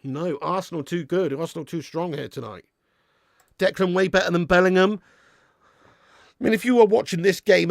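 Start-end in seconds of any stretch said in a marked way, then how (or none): none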